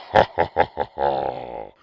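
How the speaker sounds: loud devilish cackle; evil laugh. Pretend. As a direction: cackle like Satan. Satan laughs after sign-off.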